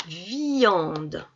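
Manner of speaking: "Viande" slowly, i held long before nasal an; drawn-out syllable. Shown as a demonstration. Teacher saying the picture's word so pupils hear ian, drawn out. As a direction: stretch the i in "Viande". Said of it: "de dire si on entend".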